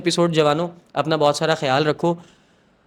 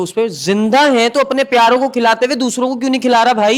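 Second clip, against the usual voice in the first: heated outburst; 8 semitones higher, 4 dB louder than usual. Real, not acted. Passionate complaint about neglecting living.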